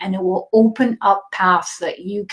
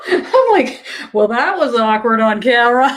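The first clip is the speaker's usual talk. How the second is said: louder, higher, talking through real laughter; laughing through it; 3 semitones higher, 3 dB louder than usual. Real, not acted. Telling viewers about the awkward burp, laughing. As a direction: laugh the words out, embarrassed.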